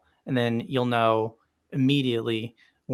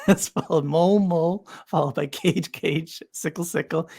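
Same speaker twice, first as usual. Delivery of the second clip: ordinary delivery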